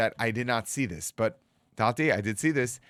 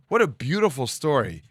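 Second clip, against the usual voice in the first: ordinary talk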